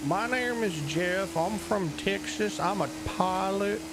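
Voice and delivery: high, slow Texan drawl; southern accent. Shown as a demonstration. Streamer demos a character voice on request, southern accent. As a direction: drag it out in a high southern twang. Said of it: "Let's hear that accent again".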